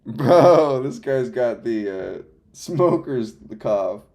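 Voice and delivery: excited. Real, not acted. Excited about a TTS voice.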